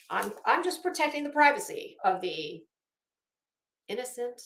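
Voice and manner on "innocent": ordinary delivery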